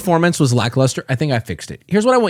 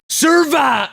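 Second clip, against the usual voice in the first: high wrestler-style shout; Hulk Hogan; 7 semitones higher than usual. Acted. Re-recording trailer audio, shouting like Hulk Hogan. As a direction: bellow it like a pro wrestler. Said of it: "I'm turning into Hulk Hogan".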